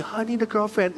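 mock lovesick whine. Mocks mere loneliness in a quote.

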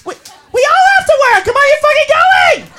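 very high, exasperated insistence; pushy ringleader at full pitch; bossy organizer friend. Acted. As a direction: browbeat a friend into coming out. Organizer friend pressing her friends.

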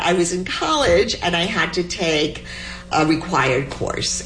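ordinary delivery

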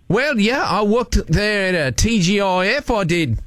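broad put-on Aussie accent; Australian accent. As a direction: greet them in a hammed-up Australian accent. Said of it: "using my Australian accent".

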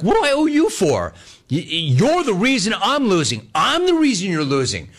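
high, indignant back-and-forth; heated arguing. Retold or imitated. Retold quarrel at blackjack table.

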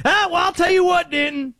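high, loud hollered call; loud high hammed-up holler; character holler. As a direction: boom it out like a hyped radio character.

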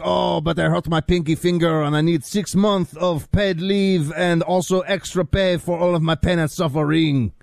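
put-on whiny high-pitched French accent; mock French accent. Co-host mocking French cops as pampered complainers, in a hammed-up French accent. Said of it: "like a guy named Francois is like".

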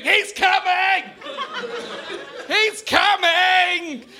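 high, loud, long-drawn mock scream; drawn-out comic panicked yell; screaming warning. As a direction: cry out for help, stretching every word. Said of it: "you can't run away screaming".

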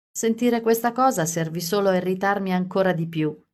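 ordinary delivery, nothing marked

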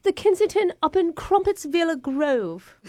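put-on posh British accent; a put-on English lady; fake British accent. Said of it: "English sounding".

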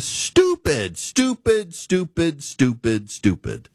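each STUPID drawn out and punched; stupid, stupid, stretched out in exasperation; exasperated repetition. Real, not acted. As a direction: hammer every "stupid" slowly, pitch leaping high then dropping. Exasperated rant about the trade fight.

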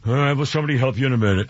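fast, dismissive brush-off; snippy bot rushing him off; mock-snotty phone assistant. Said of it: "she got snotty".

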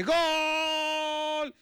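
very high, long-held goal cry. Acted. Players celebrating inside a phone booth, shouting goal. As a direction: stretch it out like a football cheer. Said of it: "de repente escuchan por ahí".

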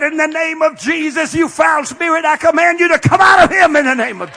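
loud, high commanding rebuke; rebuking shout. Retold or imitated. Preacher rebuking a foul spirit in a heckler, shouting. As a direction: cast out the spirit at full voice. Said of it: "I said".

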